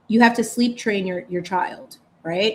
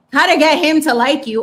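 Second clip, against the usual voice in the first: higher and louder, mocking "How to get him to like you"; mocking repeat; 5 semitones higher, 8 dB louder than usual. Mocking a Cosmo headline to listeners, louder and sarcastic. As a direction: hammer the headline again with scorn. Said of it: "and it's like".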